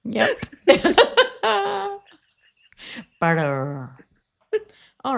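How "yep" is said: usual speaking voice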